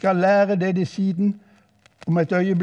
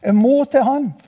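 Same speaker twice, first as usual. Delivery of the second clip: high, eager outburst; full of enthusiasm; 5 semitones higher, 4 dB louder than usual. Acted. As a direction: burst out higher, building to a louder finish. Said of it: "full av begeistring".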